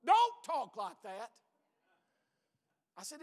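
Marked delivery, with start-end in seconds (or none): 0.1-1.3 loud, slow retold protest; husband retells wife protesting, loud and slow; wife's protest. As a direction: voice the wife's alarmed objection.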